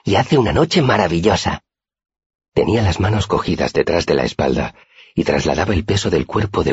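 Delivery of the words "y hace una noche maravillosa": higher-pitched, assured statement; firm. A narrator's reading. Auri praises the night to her friend, firmly, pitched up. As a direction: state it surely in a high voice. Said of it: "contestó con firmeza".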